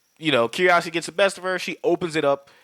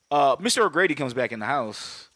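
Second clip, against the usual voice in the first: ordinary delivery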